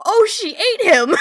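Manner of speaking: high, loud shocked yell, slow; all caps shout. Retold or imitated. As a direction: yell the note like you can't believe it. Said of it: "all caps".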